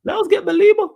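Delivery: high mocking squeak; mocking echo. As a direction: parrot his words back mockingly. Mocking the other's phrase.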